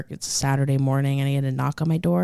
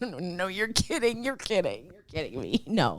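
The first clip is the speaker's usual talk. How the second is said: inner disbelief; 3 semitones higher, 10 dB quieter than usual. Retold or imitated. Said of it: "In my head, I'm just like".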